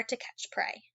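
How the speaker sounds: breathless whisper. Acted. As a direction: whisper it like a secret. A sudden whisper while reading.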